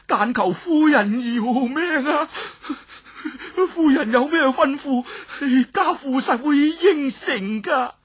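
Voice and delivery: high, tearful pleading; tearful high plea; begging for his life. Acted. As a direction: beg her on your knees, voice breaking. Kneeling man begs for life.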